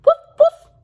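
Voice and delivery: high, loud yappy woofs; squeaky puppy woof-woof; puppy bark. Acted. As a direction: bark like a happy little puppy. Puppy barks in the story.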